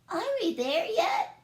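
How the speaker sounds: high, whining are-we-there-yet; whiny kid. Acted. Bork whines to siblings on the trip, high. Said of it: "asked Bork".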